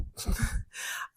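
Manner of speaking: breathless whispered shy giggle. Retold or imitated. Psychic embarrassed by cab driver, soft shy giggle. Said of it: "I get so shy".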